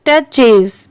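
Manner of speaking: टचेस as two syllables, "tuh-chez"; pronunciation demo. Shown as a demonstration. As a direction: sound the "es" ending in टचेस, falling. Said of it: "इसे हम क्या पढ़ेंगे". (टचेस में आखिर का "एस" साफ बोलें)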